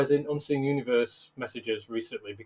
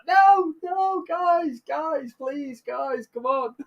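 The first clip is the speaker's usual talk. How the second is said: pleading voice; 13 semitones higher, much slower than usual. Retold or imitated. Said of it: "the male's like".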